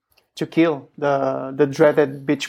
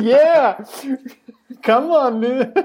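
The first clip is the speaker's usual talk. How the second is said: excited protest; 8 semitones higher, slower than usual. Real, not acted. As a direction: throw it back with excitement. Defending Patreon plug.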